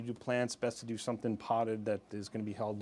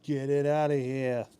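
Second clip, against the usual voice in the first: louder, drawn-out mock rejection; mock gruff dismissal; 3 semitones higher, 5 dB louder than usual. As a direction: play a fish loudly refusing its dinner. Voicing a fish rejecting food.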